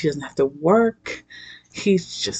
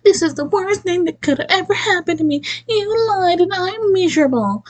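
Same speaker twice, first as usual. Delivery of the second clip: high, whiny mock voice of the heroine; hammed-up high mimicry of a melodramatic woman; 13 semitones higher than usual. Vlogger mocks the movie heroine's complaint, high whiny mimicry. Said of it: "'Cause she's like".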